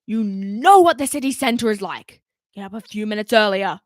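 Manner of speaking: gruff scolding. Acted. Commander still scolding.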